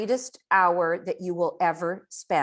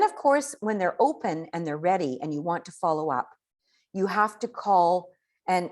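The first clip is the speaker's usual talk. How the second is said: ordinary talk, nothing marked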